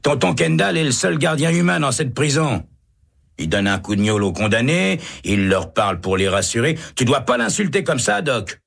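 excitable prisoner. A narrator's reading. Prisoner Hennessy shouting across cells.

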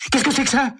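put-on high startled teen cry; alarmed, not knowing what landed; panicked. Tommy panics at the object at his feet, high and alarmed. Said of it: "s'exclama Tommy affolé".

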